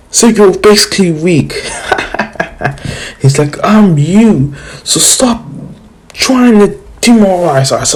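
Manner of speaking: high, bossy, squeaky voice; Shukaku from the future. Retold or imitated. Future Shukaku scolds his past self, high and bossy. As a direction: lecture your weaker self in a high voice.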